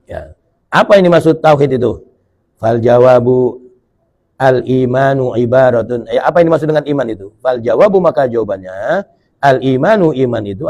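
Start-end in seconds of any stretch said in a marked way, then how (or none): 2.6-6.1 slow, measured kitab reading; reading the answer from the kitab, slow and measured; slow recitation. Shown as a demonstration. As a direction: draw out each Arabic word. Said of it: "reading Arabic text slowly".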